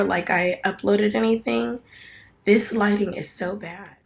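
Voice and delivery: ordinary talk, nothing marked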